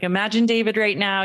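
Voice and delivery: ordinary talk, nothing marked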